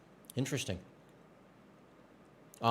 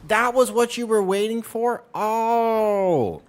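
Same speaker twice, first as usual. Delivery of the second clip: incredulous; 7 semitones higher, 7 dB louder, slower than usual. Real, not acted. Incredulous at joke.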